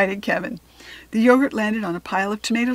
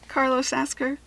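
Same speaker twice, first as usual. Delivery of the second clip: ordinary delivery, nothing marked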